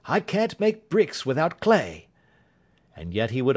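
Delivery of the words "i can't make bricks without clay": raised, exasperated detective; a touch of frustration; impatient Holmes. A narrator's reading. Holmes continues impatient outburst.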